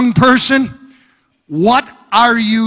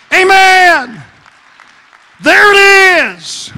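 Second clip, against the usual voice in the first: higher, louder, crying preacher's voice; preaching at full force; 4 semitones higher, 5 dB louder than usual. Acted. Preacher speaking God's promise to the church, full-force preaching. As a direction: proclaim it over the congregation. Sermon climax, hallelujah.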